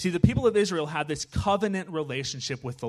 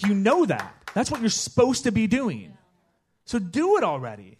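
higher and louder, exasperated prophet; impatient "just do it"; exasperated scolding; 6 semitones higher, 4 dB louder than usual. Acted.

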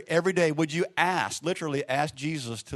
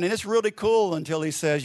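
ordinary talk, nothing marked

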